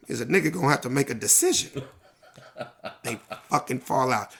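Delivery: normal talking voice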